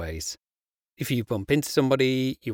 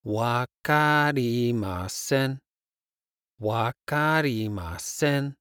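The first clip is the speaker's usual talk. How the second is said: slow; slower than usual. Shown as a demonstration. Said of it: "I don't understand".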